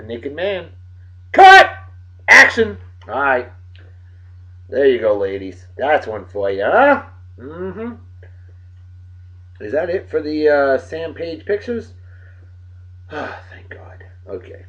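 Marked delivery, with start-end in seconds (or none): 1.3-2.8 high loud film director shouts; director calls. Pretend. Host playing a director, shouting cut and action. 13.1-14.6 soft whispered relief; relieved whisper. Real, not acted. Host to viewers, relieved the pictures are done, whispering.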